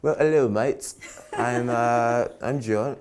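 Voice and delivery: hammy high fake British accent, laughing; bad British accent. Fighter greeting UK fans in a terrible mock British accent. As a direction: put on a terrible Cockney voice for the fans. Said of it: "That was terrible".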